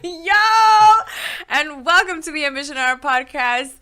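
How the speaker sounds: loud, high-pitched hype show opener; super hyped. Real, not acted. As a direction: shout the welcome, stretching the final word high. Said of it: "super hyped me up".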